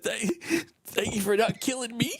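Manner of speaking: imitated high, tearful thanks; almost crying. Suspect thanking the officer, imitated as almost crying. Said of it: "like, almost crying".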